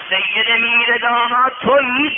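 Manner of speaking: loud, high, drawn-out challenge; acted official shout; officer's demand. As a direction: call him out like an arresting officer. King's officers demand the student.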